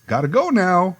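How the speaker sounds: high, chirpy clown goodbye. Retold or imitated. Clown bidding the kids goodbye, high and chirpy. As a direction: sing it out like a cheerful clown. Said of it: "Then he'd say".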